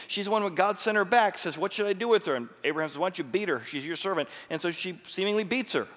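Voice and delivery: loud, high, driving sermon delivery; full-force preaching. Real, not acted. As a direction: preach it out with fire.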